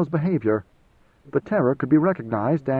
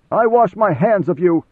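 louder, higher, exasperated dismissal; angry outburst; 5 semitones higher, 6 dB louder than usual. A narrator's reading. Messenger leaping up to abandon the commander, angrily exclaiming. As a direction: fling it out loudly and be done.

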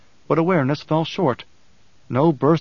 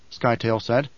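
ordinary delivery, nothing marked

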